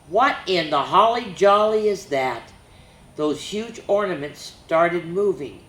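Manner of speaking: put-on bouncy rhyming read-aloud for kids; lively story reading. A narrator's reading. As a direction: read it like a picture book to children. Reads a rhyming reindeer story.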